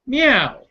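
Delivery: playful cat sound; meow. Acted. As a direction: mew like a cat for the kids. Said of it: "Cats went".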